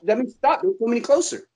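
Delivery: high-pitched startled "stop, don't come closer"; startled high warning. Retold or imitated. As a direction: yelp it out like a spooked soldier. Retelling a soldier warning someone off.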